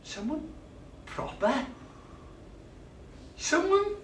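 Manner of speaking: rising high, incredulous; stunned disbelief. Acted. Dumped man repeating her words, stunned disbelief. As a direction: echo it in shock.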